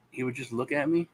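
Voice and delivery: usual speaking voice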